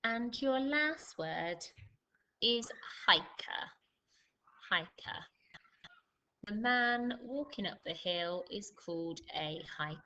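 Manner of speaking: slow, measured word-by-word dictation; spelling-test dictation. Acted. Teacher giving pupils their spelling test, slow dictation. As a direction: read out each test word slowly, with pauses.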